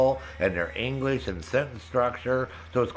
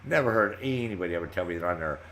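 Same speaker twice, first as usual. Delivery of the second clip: ordinary delivery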